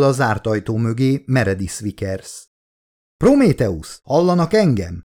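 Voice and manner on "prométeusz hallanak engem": higher-pitched calling out; narrated shout, moderately restrained; captain yelling. A narrator's reading. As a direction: call urgently to an unanswering crew. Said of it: "üvöltözött a kapitány továbbra is".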